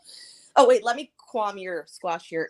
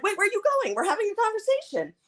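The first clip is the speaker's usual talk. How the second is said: high-pitched imitated protest; mock protest; 11 semitones higher than usual. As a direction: mimic her calling him back, pitched way up. Mimicking her protest as he leaves.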